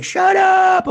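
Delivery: high, loud squeal of disbelief; excited high-pitched yell; disbelieving shout. Retold or imitated. Replays own reaction, repeated.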